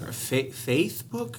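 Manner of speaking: slow high lisped Faithbook; a light mock lisp. Shown as a demonstration. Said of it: "kids with lisps".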